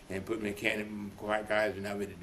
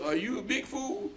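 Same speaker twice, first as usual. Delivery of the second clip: high, incredulous wife voice; scolding wife; 13 semitones higher than usual. Retold or imitated. Wife scolding her husband again, high and incredulous. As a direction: play the wife, pitched up and disbelieving.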